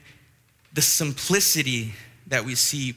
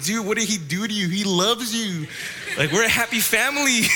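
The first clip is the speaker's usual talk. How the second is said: mock sad protest; 5 semitones higher than usual. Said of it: "I was so sad".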